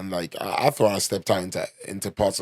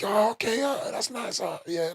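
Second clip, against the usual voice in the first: high, soft, put-on voice of bored strangers; mock unimpressed locals; 12 semitones higher, 3 dB quieter than usual. Locals reply to his London story, high and softly mocking. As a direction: mimic them shrugging it off.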